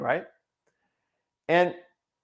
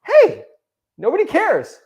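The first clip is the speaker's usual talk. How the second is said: much higher, louder, bright "Hey, nobody cares" as good news; cheerful relief; 9 dB louder, slower than usual. Shown as a demonstration. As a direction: announce "nobody cares" like a liberating discovery. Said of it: "It's the same sentence, but people can put it either one way or the other".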